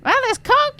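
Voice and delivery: high-pitched mock complaint; imitated frustrated kid. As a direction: whine it high like an upset child. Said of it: "Odin's frustrated".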